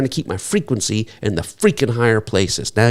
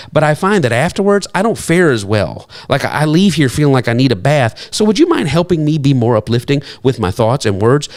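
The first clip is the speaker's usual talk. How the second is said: normal talking voice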